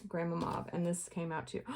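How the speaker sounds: ordinary talk, nothing marked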